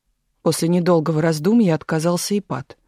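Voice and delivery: usual speaking voice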